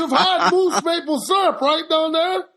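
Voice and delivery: high-pitched, hammed-up Canadian accent; excitable mock-Canadian hoser. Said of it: "talk in my Canadian accent".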